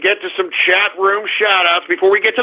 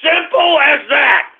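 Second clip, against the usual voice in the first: slow, high shouted punchline; emphatic holler; 6 semitones higher, slower than usual. Pretend. Host caps his heckling lesson, slow emphatic holler. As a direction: holler each word slowly. Emphatic sign-off after taunt.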